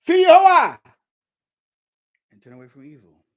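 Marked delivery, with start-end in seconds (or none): 0.0-0.8 high, loud preaching cry; commanding shout. Acted. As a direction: hurl the command at the congregation.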